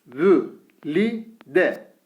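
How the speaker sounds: Vü said with thin Ü, each syllable drawn out; syllable drill with thin vowel. Shown as a demonstration. Teacher reads the thin-vowel syllables to students, slowly. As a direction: read out each syllable apart. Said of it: "vü diye okuyoruz".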